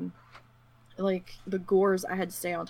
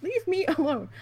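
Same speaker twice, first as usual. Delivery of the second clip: annoyed; 8 semitones higher, 3 dB louder than usual. Retold or imitated.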